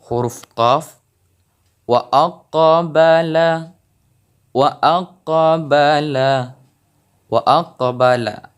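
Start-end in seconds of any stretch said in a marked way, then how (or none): 1.9-8.5 slow "Wa aqbala" with the q in "aqbala" bouncing out; qalqalah on qaf. Shown as a demonstration. Teacher demonstrating qalqalah to viewers, slow bounced qaf. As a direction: let the qaf in "aqbala" echo with a small rebound, slowly. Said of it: "ada pantulan sedikit di sini".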